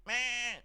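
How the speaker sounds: high, imitated goat bleating. Streamer setting up a goat gag, high bleat. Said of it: "Islam beating his goat wife".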